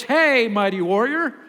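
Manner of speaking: sarcastic. Retold or imitated. Angel greeting a man hiding in a hole, sarcastic. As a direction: tease him with a grand title. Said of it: "the angel's being a little sarcastic".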